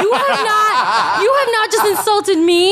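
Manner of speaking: high, slow, laughing outrage; mock-offended through laughter; laughing indignation. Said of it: "I was like".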